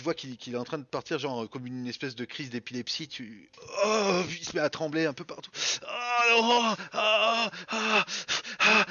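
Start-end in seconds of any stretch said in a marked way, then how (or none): 5.8-8.8 high shaking cries of a fit; seizure moans. Acted. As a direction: moan through a seizure. Cooper fitting, crying out. (gémissements de crise)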